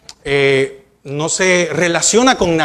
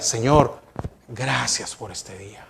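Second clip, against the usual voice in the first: much softer and lower, "gracias por este día" almost hushed; heartfelt quiet prayer; 9 semitones lower, 10 dB quieter than usual. Shown as a demonstration. Pastor models a sincere, hushed thanks to the Lord. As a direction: pray it gently, as if speaking only to God. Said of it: "No, yo diga".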